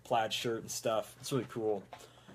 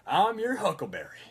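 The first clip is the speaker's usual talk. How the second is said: put-on drawling Texas cowboy accent; McCree; 4 semitones higher, 3 dB louder than usual. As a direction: play a cocky Texas gunslinger. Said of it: "Oh, sing as McCree".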